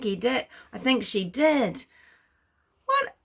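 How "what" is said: sharp, much higher disbelieving what; incredulous what. Real, not acted. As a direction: snap it out in shocked disbelief. Outraged at the Polish news.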